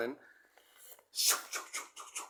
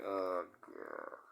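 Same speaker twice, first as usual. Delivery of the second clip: dismayed inner groan; 8 semitones lower, 10 dB quieter, much slower than usual. Retold or imitated. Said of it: "And I'm like".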